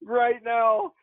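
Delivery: imitating his own stunned disbelief on set; incredulous retold outburst. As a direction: replay the outrage of losing the take, voice up high. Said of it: "I was like".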